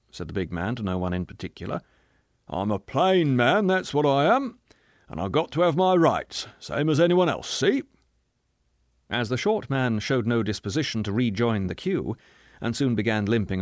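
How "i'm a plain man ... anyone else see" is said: higher and louder, self-righteous; a coarse man defending a punch, louder; blustering bully. Acted. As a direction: insist on your rights to everyone. Big man justifying himself aloud.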